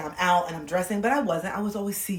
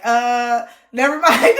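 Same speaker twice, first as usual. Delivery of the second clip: high, loud laughing "never mind"; as the guy, giving up loudly; backing off; 3 semitones higher, 11 dB louder, much slower than usual. Pretend. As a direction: retreat with a laugh. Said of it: "I'd be like".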